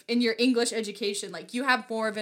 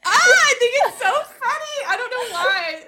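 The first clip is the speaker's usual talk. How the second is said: very high, loud, laughing outburst; delighted laughing squeal; 14 semitones higher, 9 dB louder than usual. Real, not acted. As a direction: squeal through real laughter, tickled by what you heard. Delighted by the demonstrated accent.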